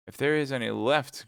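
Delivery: jeering grumble. Acted. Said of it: "grumbled the jeering voice of Athos".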